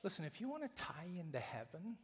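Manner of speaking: ordinary talk, nothing marked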